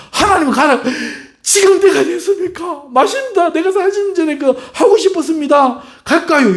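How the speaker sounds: high, eager voice of a willing Moses. Pretend. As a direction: play Moses jumping at the call. Preacher acts eager Moses. (신나서 들뜬 모세 목소리)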